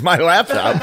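put-on high, laughing kid voice; laughing reply. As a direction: blurt it through a laugh. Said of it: "She goes".

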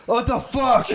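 high, startled outburst through a laugh; mock yell of someone jolted from sleep; startled awake yell. Telling friends how wife woke to the smell, startled. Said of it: "She popped up and was like".

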